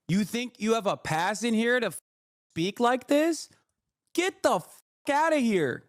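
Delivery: exasperated outburst. Real, not acted. Streamer telling off a rude chatter, indignant and high.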